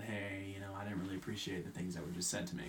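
softly voiced retold server; low, soft, confidential report; quiet confiding. Server tells narrator about rude customers, quiet and low.

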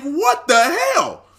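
high, drawn-out astonishment; retold disbelief. Storyteller reliving shock at the manager, high and incredulous. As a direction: stretch it out in laughing disbelief. Retells his astonished reaction.

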